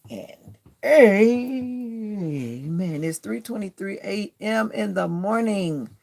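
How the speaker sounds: loud, high, drawn-out amen launching the show; big livestream-opener call; exuberant greeting. Real, not acted. Bursts out of prayer into greeting.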